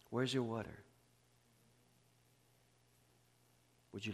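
very soft, low, with long pauses; altar call and prayer. Real, not acted. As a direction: gently invite the congregation into hushed prayer.

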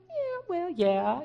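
high, little sing-song voice; small high-pitched voice, not really meaning it; insincere sing-song. Retold or imitated. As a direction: sound half-hearted, sliding down from a high pitch. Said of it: "kind of that sing-song little voice that when you're not really sincere".

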